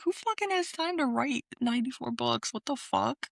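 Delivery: high-pitched disbelief, retold; incredulous retort. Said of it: "I was like".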